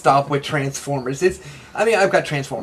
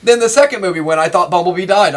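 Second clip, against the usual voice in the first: normal talking voice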